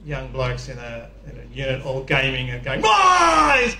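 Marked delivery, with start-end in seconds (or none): 2.8-3.8 put-on loud high call of boys; shouting. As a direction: yell into the room from the door. Girlfriends calling into smelly room.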